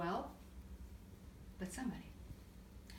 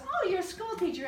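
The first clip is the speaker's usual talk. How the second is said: waitress voice; 9 semitones higher, 6 dB louder than usual. Retold or imitated. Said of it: "She goes".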